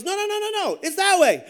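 high-pitched, insistent mock protest; heated contradiction. Another lost swimmer arguing the way back, heated contradiction. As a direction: pitch the string of no's way up, then land loud.

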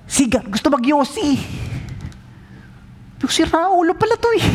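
high, rising mock alarm; mock shock. Pastor reacts to cigar request, high mock shock. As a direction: squeal it in disbelief.

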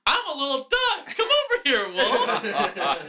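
very high cartoon duck call; squeaky duck voice. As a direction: lure the wolf in a tiny duck voice. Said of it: "button that says".

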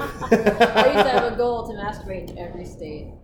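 loud laughing confession. Real, not acted.

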